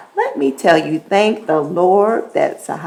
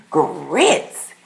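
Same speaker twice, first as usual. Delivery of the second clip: ordinary talk, nothing marked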